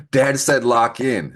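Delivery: giggling through the words; laughing. Real, not acted. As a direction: laugh while saying it. Laughs at own joke.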